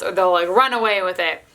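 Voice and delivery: ordinary delivery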